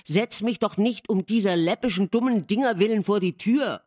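loud, insistent plea; stubborn protest. A narrator's reading. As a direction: push back hard, refusing to give in. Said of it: "meinte er hartnäckig".

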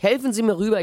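put-on boy asks the old man for help, high and eager. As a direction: ask for a leg up. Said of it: "rief ich".

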